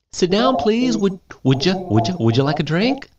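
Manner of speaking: high, sweet host voice; hostess. Retold or imitated. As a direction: play the gracious hostess.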